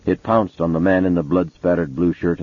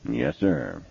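soft, higher, deferential reply; respectful; 7 semitones higher, 6 dB quieter than usual. A narrator's reading. Townsend agreeing with Mason, softly respectful. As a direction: answer softly, yielding to the boss. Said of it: "Townsend said respectfully".

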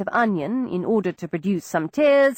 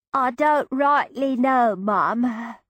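put-on Cockney girl; 4 semitones higher, much slower than usual. Peggy answers in Cockney.

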